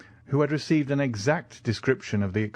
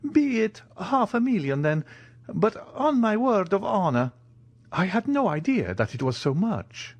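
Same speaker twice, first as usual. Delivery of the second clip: put-on high-pitched old soldier, impressed and flustered; surprised Italian major; 10 semitones higher than usual. Major amazed at his own fortune, high flustered voice. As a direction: protest innocently in a higher voice.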